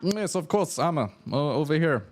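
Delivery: high-pitched eager smith, mock Russian accent; attempted Russian accent. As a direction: show off wares in a put-on Russian accent. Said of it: "I'm trying to do a Russian accent".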